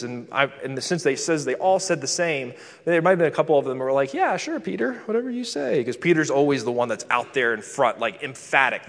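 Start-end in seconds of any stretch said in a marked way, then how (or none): 4.1-5.8 much higher-pitched, dismissive going-along; mock agreeing. A couple of disciples humouring Peter, mock agreeing. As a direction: put on a high voice to humour him.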